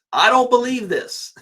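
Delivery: high, loud, incredulous outburst; can't believe what he's reading; disbelief. Real, not acted. Host reacting to viewers about bank forecast, loud disbelief. Disbelief at Wells Fargo prediction.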